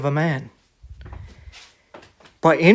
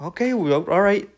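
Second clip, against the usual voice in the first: startled backing off; 3 semitones higher than usual. Retold or imitated. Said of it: "and you're like".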